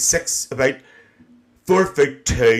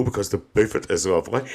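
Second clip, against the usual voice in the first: ordinary delivery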